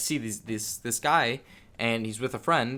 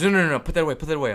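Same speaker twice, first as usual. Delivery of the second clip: higher, louder hushing-off of a buyer; urgent refusal; 5 semitones higher, 6 dB louder, much faster than usual. Retold or imitated. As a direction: imitate a dealer urgently waving something off. Dealer hurriedly refusing visible cash.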